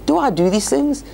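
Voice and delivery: the loyal donkey begging its master, hammed up; mock donkey voice. Donkey pleads with Bilam.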